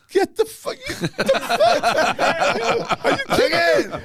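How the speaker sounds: very high, laughing mock squawk; hammed-up disbelief breaking into laughter; incredulous outrage. Singer sees tour manager coming back yet again, incredulous. Retold outrage at third replay demand.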